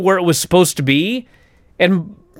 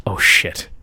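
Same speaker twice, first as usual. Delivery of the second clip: whispered alarm; 8 semitones lower than usual. Retold or imitated. Alarmed inner reaction after pop.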